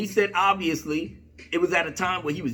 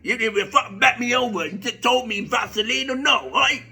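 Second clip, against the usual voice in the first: hammed-up British geezer accent; comic British impression, getting more worked up. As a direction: rant in a mock British accent. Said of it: "he's saying in his British accent".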